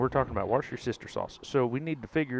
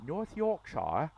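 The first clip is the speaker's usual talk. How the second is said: North Yorkshire slow, pitched higher with a questioning rise; slow, higher questioning British-style North Yorkshire; mock British accent; 6 semitones higher, 4 dB quieter, slower than usual. Shown as a demonstration. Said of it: "that's a little bit easier".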